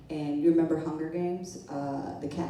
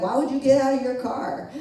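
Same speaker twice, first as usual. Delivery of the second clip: higher, louder mock scolding; bitching, imitated; 7 semitones higher, 6 dB louder than usual. Cop griping at the cab driver, bitching. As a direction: complain at him like an exasperated cop. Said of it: "he starts bitching at the cab driver".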